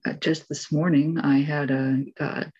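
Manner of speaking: ordinary talk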